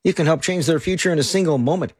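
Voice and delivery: ordinary talk, nothing marked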